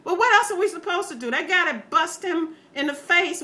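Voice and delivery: ordinary delivery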